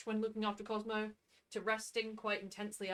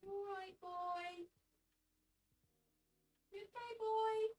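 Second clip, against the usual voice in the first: high, soft pet talk; talking to a dog; 9 semitones higher, 8 dB quieter, slower than usual. Real, not acted. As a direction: coo gently at the dog. Talking to her dizzy dog.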